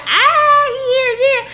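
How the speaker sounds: nervous squeal. Retold or imitated. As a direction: squeak it out, flustered. Awkward reaction to her girl.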